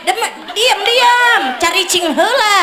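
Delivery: high, sharp call of diam, diam; mock scolding. Preacher playfully scolds the husbands for answering, high sharp hush.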